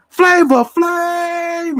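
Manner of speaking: very high, drawn-out shouted name. Retold or imitated. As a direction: stretch the name into a slow, high yell. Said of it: "And he was like".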